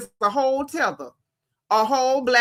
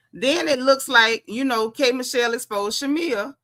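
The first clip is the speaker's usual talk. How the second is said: ordinary talk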